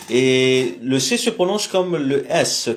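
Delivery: usual speaking voice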